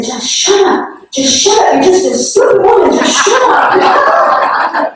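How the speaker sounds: high, slow, angry shout of shut up; imitating him snapping shut up at her; retold yell. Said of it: "he yelled at me".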